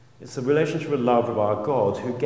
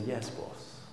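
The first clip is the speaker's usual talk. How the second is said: fond yes boss; 14 dB quieter than usual. Shown as a demonstration. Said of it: "you've got to do it with a smile".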